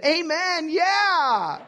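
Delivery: hammed-up churchgoer cheer; mock congregation amen. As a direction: parody an eager crowd agreeing. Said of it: "Everyone said".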